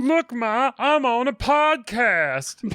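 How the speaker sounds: put-on high, slow little kid voice; excited kid voice. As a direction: squeal it like a proud child. Proud kid voice to mom.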